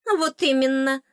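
a touch of a fairy's huffy snort; mild huffy fairy. A narrator's reading. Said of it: "фыркнула фейка".

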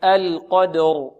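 slow القدر, heavy qaf and ra; recite with me. Shown as a demonstration. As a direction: model القدر for students to repeat. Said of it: "انطقوا معي". (انطقوا معي القدر)

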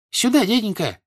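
high-pitched call; shouting girl. A narrator's reading. Servant girl calls Pierre over, shouting. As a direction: call him over in a high girlish cry. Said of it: "крикнула девка".

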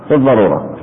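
ordinary delivery

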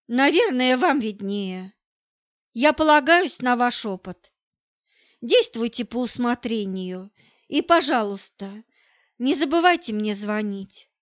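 ordinary delivery

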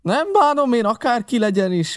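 high, loud outburst; Silver's shout. A narrator's reading. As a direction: cry out over everyone. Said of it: "kiáltotta Silver".

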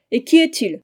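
indignant cry. A narrator's reading. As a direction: throw the question out in defiance. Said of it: "s'écria la reine".

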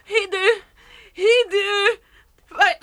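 high, loud cries of pain and panic; desperate shrieks, calling her son; screaming in pain. Acted. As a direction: scream for help after a bad fall. Mother falls down the stairs.

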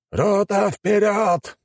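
high barked order to charge; shouted command. A narrator's reading. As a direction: yell it across the battlefield. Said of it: "приказал османский командир". (командный крик)

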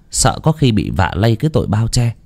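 usual speaking voice

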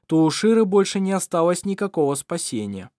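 plain reading voice

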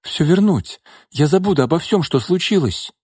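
plead, eager to forgive; pleading woman. A narrator's reading. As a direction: Nina begging Gordey for another try. Nina pleads.

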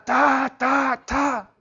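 spoken knocking sounds, "ta, ta, ta"; three punched knock noises; imitated door banging. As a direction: mimic pounding on a door. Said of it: "Llegó y azotó la puerta".

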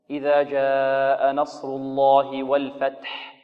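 الله heavy and والفتح light; slow model recitation. Shown as a demonstration. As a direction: recite slowly, heavy lam, light الفتح. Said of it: "كلمة الفتح هذه كلها مرققة". (تلاوة نموذجية بطيئة)

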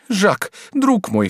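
high, drawn-out woman's cry; pleading cry. A narrator's reading. Woman calls on her lover at judge's door, pleading cry. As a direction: cry out slowly to a dear friend. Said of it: "вскричала Жервеза".

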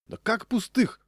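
high, outraged exclamation; indignant cry. A narrator's reading. Diner protests to the old woman, indignant cry. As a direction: cry out in disbelief, pitch leaping up. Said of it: "закричал я".

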